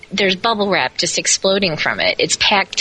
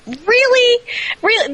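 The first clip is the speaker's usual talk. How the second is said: incredulous really; 14 semitones higher, slower than usual. Retold or imitated. Answering the ex-boss's apology, high and incredulous. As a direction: throw the word back in disbelief. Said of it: "And I'm like".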